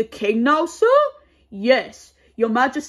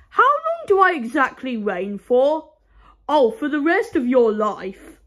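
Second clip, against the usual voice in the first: usual speaking voice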